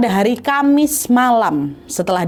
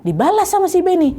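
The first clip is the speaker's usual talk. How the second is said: usual speaking voice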